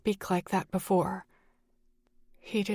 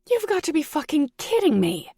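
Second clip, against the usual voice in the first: much higher, loud shriek; 9 semitones higher, 5 dB louder than usual. A narrator's reading. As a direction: shriek in outraged disbelief. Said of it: "I shrieked".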